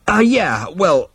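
high, awkward interjection; flustered hesitation. A narrator's reading. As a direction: squirm under an elder's rebuke. Zaphod squirms under rebuke.

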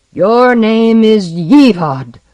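slow, loud and ominous; booming dragon voice. Acted. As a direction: pronounce his doom slowly. Dragon turns the name back.

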